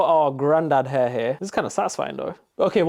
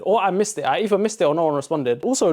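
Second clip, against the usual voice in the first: ordinary talk, nothing marked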